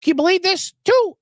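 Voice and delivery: high, urgent movie-character impression; high-pitched, pushy mock outrage; frantic movie catchphrase. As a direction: imitate a worked-up guy demanding the phone. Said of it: "He goes".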